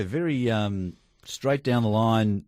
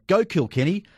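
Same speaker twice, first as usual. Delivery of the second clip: mock yell, pitched high; retold crowd yell; 8 semitones higher than usual. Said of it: "when somebody yells out".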